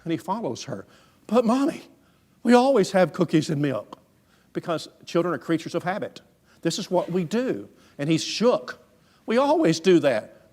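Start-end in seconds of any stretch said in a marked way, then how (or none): 1.3-4.0 put-on high, loud child's protest; imitated child's indignant plea; protesting little boy. Boy protests to his mother. 9.2-10.5 high, loud, slow protest; imitated child's dismayed objection; shaken child. Shaken boy protests to mother.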